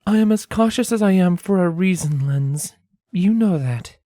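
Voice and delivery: high voice, matter-of-fact; Scarlet, plainly. A narrator's reading. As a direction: state it flatly to Lens. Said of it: "she pointed out plainly".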